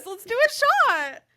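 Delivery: high, keen mock agreement; eager yes. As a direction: jump at the shot in a high voice. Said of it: "She's like".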